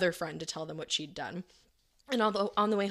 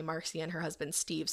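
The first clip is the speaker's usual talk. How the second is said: normal talking voice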